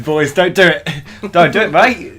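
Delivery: loud pleading warning; mock-earnest shouted warning; mock plea. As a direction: call out to the lads, hammed-up. Mock warning about circumcision.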